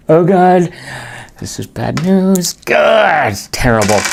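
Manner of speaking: high, loud cry of dismay at bad news; real anguish over a lost battle; dismayed groan. Real, not acted. Dismay at losing Louisbourg.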